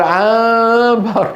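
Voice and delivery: عنبر dragged out very slowly and high, holding the nasal with lips apart; laboured over-articulation. Shown as a demonstration. Teacher mocks the needless torment, عنبر laboured and drawn out. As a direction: torture the word عنبر, stretched and high. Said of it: "ليش هذا العذاب؟".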